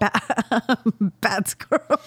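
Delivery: giggling through a mock scold; laughing scold. Scolding squirrel while laughing.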